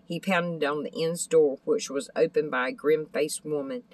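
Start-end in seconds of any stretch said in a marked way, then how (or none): none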